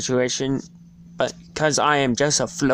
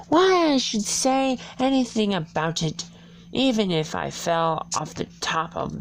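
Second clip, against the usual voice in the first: put-on much higher girl's voice; young Alice boasting, pitched high; Alice's voice; 8 semitones higher, 4 dB quieter than usual. A narrator's reading. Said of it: "thought Alice to herself".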